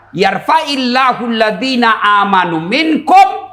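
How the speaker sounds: slow, chanted Arabic verse; Quran recitation. Real, not acted. Preacher reciting a Quran verse to students. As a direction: intone the verse slowly.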